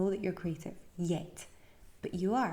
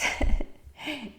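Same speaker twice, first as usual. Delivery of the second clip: laugh; 6 semitones higher, 8 dB louder than usual. Real, not acted. Laughs after yawning.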